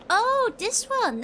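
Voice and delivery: high, loud, drawn-out surprise; sudden realisation. Real, not acted. Gamer suddenly getting the puzzle, surprised high exclamation. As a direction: let the discovery burst out slowly. Suddenly spots the game's answer.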